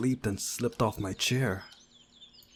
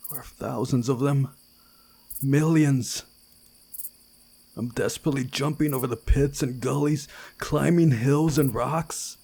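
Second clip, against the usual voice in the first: rising panic; 4 semitones higher, 6 dB louder than usual. Acted. Nightmare of chasing grasshoppers.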